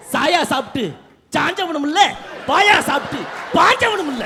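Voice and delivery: loud, high threatening brother; swaggering movie one liner. Acted. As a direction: bark it like a tough elder brother. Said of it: "புதுசா ஏதாவது சொல்லு".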